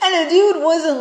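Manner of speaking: laughing through the words; high laughing burst. Real, not acted. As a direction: laugh it out, amused. Laughing mid-story.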